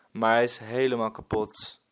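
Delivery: usual speaking voice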